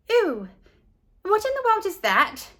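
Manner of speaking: put-on high, disgusted girl's voice; grossed-out girl. A narrator's reading. Leslie eyeing Carla's sandwich, high grossed-out voice. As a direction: recoil at the smelly sandwich. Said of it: "asked Leslie".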